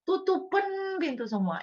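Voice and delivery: high, slow strained plea; mock pleading prayer. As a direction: squeal the demand at God. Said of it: "Karena kalau Anda ngomong".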